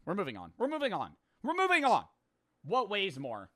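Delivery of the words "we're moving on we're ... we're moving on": climbing, increasingly frantic repetition; escalating insistence. Real, not acted. Repeats phrase, escalating.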